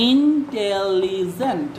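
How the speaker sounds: Intelligent very slow, each syllable drawn into the next; stretched syllables. Shown as a demonstration. Teacher repeating the word's breaks for students, intelligent stretched out syllable by syllable. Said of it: "এই যে আপনি অনেকগুলো ভাঙ্গা দিলেন".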